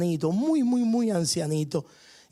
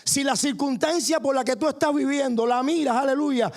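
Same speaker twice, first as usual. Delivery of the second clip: rising sermon fervor; 7 semitones higher than usual. Acted. Preacher urging the congregation to see through faith, fervently. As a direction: preach it out with growing conviction.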